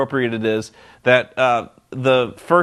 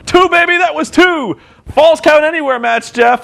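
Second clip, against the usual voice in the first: much higher, loud hammed-up ref shout; excited referee yell; 13 semitones higher, 6 dB louder than usual. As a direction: belt it out like an overexcited referee. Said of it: "he's like".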